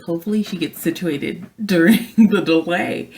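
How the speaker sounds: hope it out with a nervous laugh. Retold or imitated. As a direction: crew hoping the child calms, laughing nervously. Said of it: "we're like".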